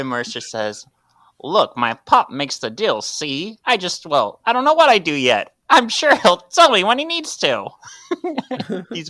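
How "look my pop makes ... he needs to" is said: high, loud hammy mobster; mob voice. Acted. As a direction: overdo a young wiseguy, rising. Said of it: "I'm trying to do a mob voice".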